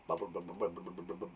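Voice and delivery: very low, soft, rapid bubbling; soft, tumbling bubble sounds; bubbling water. Shown as a demonstration. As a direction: burble the word over and over. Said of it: "Sounds like bubble, doesn't it?".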